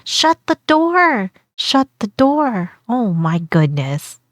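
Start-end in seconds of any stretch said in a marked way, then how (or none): 0.0-2.7 slow, higher pleading command; drawn-out shut the door; urgent plea. Real, not acted. Yelling advice at the video.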